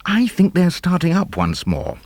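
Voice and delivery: nervously doubt the snail; a touch of fear to the snail; worried. A narrator's reading. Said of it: "I said".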